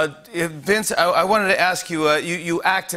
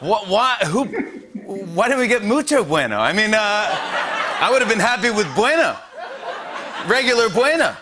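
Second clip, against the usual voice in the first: mock outrage; 6 semitones higher than usual. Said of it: "I go".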